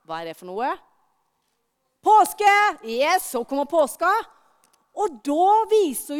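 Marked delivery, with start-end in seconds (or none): none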